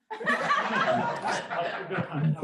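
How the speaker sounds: bursting out laughing, high pitched; a sudden high giggle. Real, not acted. Laughs at the envelope moment.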